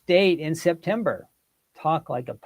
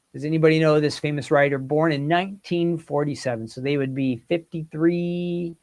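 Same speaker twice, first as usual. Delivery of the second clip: usual speaking voice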